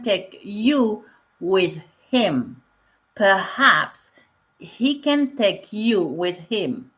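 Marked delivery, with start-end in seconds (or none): 3.2-4.1 "Perhaps" with a clearly breathed H in the middle, per-HAPS; teacher models "Perhaps", pushing air on the H; H sounded. Shown as a demonstration. Teacher showing students how to say "Perhaps" with the H. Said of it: "n'oubliez pas le H au milieu, il faut le prononcer".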